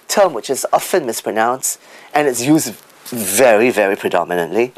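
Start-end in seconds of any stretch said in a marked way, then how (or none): none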